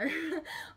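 a quick sheepish laugh; brief embarrassed chuckle; short laugh. Real, not acted. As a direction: laugh it off, caught unprepared. Embarrassed about not googling it.

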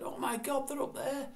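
high-pitched, acted-out astonishment; mock starstruck gasp of words; amazed exclamation. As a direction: re-enact spotting idols up above, pitch leaping. Acted quote, friend spots band overhead.

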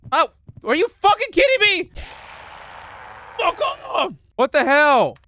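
high, loud screech; outraged yelling. Real, not acted. Player caught by the enemy, outraged. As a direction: scream in furious disbelief.

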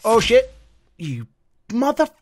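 loud, high shocked curse; startled curse. Real, not acted. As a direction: blurt it out in sudden panic. Sudden danger in game.

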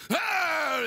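sudden high "ah!" cry; imitated preacher's outburst. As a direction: burst out abruptly, imitating an excitable preacher. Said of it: "he'd go".

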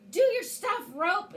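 high, loud cry to the rope; rousing command. A narrator's reading. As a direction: urge the lariat on with a high-pitched call. Heroine commands her lariat.

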